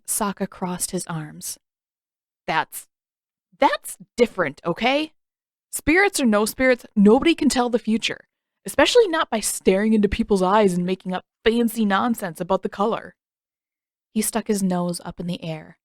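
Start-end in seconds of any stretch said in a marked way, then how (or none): 2.5-5.1 flustered, stammering protest; spluttering. Acted. Sokka splutters back at Aang, flustered. 5.7-13.1 loud, insistent rebuttal; heated argument. Acted. Sokka argues with Aang about fortune telling, loud.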